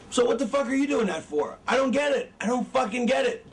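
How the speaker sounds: ordinary delivery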